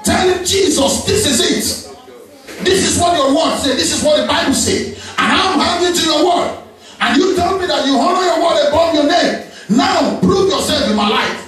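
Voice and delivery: fervent high preaching; rising, impassioned sermon voice; preaching at full force. Real, not acted.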